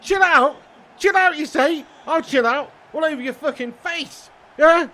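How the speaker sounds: loud, high ranting outburst; yelling back indignantly; mock rant. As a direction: blow up in mock fury at being told to chill.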